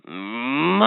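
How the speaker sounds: a low drawn-out moo; low cow moo. Acted. Toy quizzing the child, a low cow moo.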